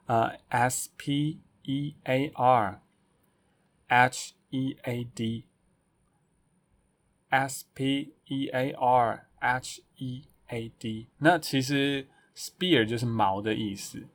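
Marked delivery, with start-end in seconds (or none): 0.0-11.1 slow letter-by-letter spelling. Shown as a demonstration. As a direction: spell each letter slowly. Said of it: "补充一下前面那个spearheaded".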